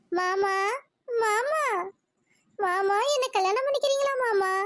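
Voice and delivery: hammed-up bashful sweetheart; coy, shy. Girl asks her cousin to marry her, coyly bashful. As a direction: play it shy, lifting the pitch as it goes on. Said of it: "எனக்கு ரொம்ப வெக்க வெக்கமா வருதே".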